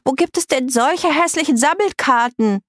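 put-on high, nasal girl's outrage; appalled, high-pitched complaint; disgusted shrieking. A narrator's reading. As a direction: squawk the question in revulsion. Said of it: "kreischte Greta".

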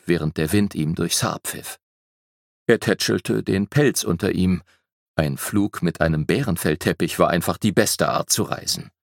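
ordinary delivery

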